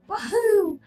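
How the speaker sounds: quick high cheer, Mario-style; Mario's Wahoo. Retold or imitated. Naming the Mario cheer Chris Pratt skipped, high mock cry. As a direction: toss off the famous Mario yell, lightly. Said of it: "didn't go, like".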